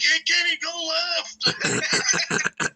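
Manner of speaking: high holler from afar; mock yell out a window; imitated yelling. Yelling out the window to steer the neighbour, high holler. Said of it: "I'm yelling out the window".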